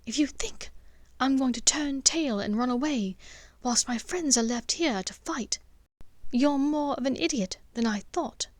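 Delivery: rising fury, peaking at the end; angry retort. Acted.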